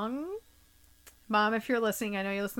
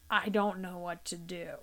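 softer, lost murmur; helpless; 3 semitones lower, 7 dB quieter than usual. Retold or imitated. Helpless sister far from family admits it, softer. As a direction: let it fall away. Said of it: "I felt so helpless".

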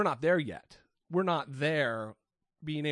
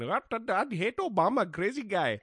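mock Indian accent; 5 semitones higher than usual. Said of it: "I was going".

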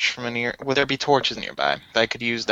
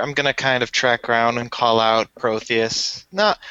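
normal talking voice

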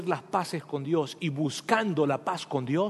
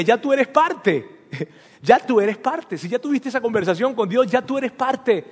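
reassuring God voice; 6 semitones higher, 5 dB louder than usual. Retold or imitated. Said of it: "Dios te contestaría".

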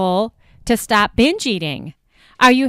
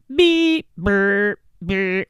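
imitated game-show buzzer; much slower than usual. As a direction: mimic a wrong-answer buzzer by mouth. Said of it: "press a little buzzer right now".

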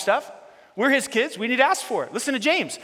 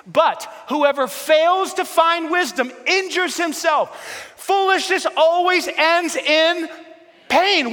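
preaching shout; 6 semitones higher, slower than usual. Acted. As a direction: hammer the warning home to the congregation. Preacher proclaims scripture warning.

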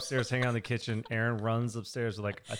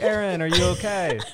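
higher, louder concerned call; anxious check-in, raised voice; worried call; 6 semitones higher, 6 dB louder than usual. Retold or imitated. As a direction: call out to her through the door. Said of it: "And I'm like".

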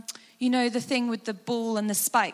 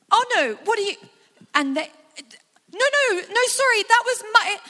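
high, loud frantic pleading; panicked; 10 semitones higher, 7 dB louder than usual. Acted. Listener watching Jesus beaten, frantic and high. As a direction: rush in, horrified.

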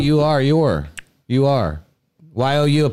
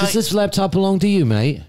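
put-on British accent; 6 semitones higher than usual. British voice after accent talk.

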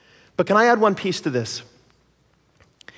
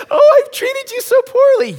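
much higher, louder, slow falsetto sobbing; mock crying wife; 9 dB louder than usual. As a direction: wail the apology like a tearful wife. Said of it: "starts crying".

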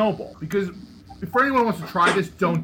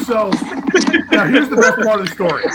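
laughing; 5 semitones higher, 8 dB louder than usual. Real, not acted. Laughing while telling story.

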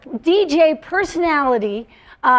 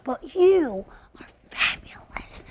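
whisper; 6 dB quieter than usual. Real, not acted. Aside compliment to guest.

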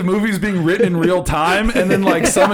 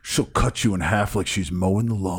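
much lower, quieter gruff movie line; tough-guy impression; 6 dB quieter than usual. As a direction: growl it low like a cheesy action hero. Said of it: "he goes".

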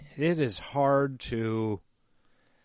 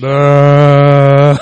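loud sung note; 3 semitones higher, 21 dB louder than usual. Pretend.

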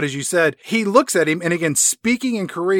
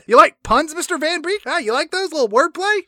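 high, hammed-up chummy villain; pleased-with-himself joker, pitched way up; Colonel Moon; 9 semitones higher than usual. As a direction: tease in a high mock-American voice. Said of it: "Moon is like".

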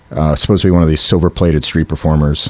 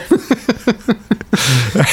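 soft high giggle; high laugh; 14 semitones higher, 3 dB quieter than usual. Real, not acted. Storyteller laughing at the knocked-off product, high giggle.

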